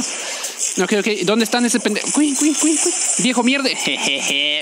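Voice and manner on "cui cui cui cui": high little cuy chirps; imitated chirps. Streamer mimicking the game, high chirps. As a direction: chirp like a tiny critter.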